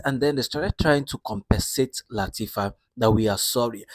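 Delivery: ordinary talk, nothing marked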